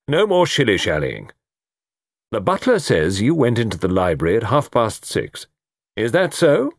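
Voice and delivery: no-nonsense chief constable questioning; brisk colonel. Acted. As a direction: take charge and press for the facts. Colonel takes charge.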